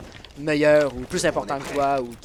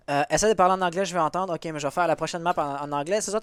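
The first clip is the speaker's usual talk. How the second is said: ordinary talk, nothing marked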